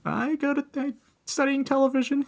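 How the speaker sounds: high, meek self-impression; high timid voice. Imitating himself answering the host, timid and high. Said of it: "I was like".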